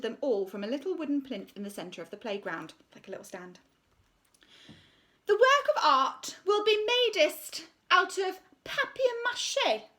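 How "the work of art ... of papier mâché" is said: high, slow, self-important voice; pompous art teacher. Acted. Art teacher announcing her decision to the school, pompous and high. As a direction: preen over the assembly. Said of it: "announced Miss C-, Conceit".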